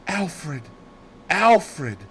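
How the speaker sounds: a dying woman crying out to the doctor; strangled cry. Acted. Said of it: "cried out in a strangled voice".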